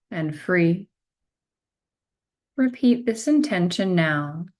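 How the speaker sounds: slow, measured meditation guide voice with long pauses; guided meditation. Acted. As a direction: guide them gently into stillness, one phrase at a time.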